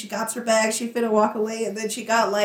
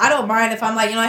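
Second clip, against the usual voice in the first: ordinary talk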